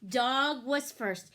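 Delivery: plain reading voice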